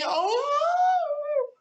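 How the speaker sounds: very high loud oh; a sharp hammed-up squeal; high shriek. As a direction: shriek suddenly. Queen cries out.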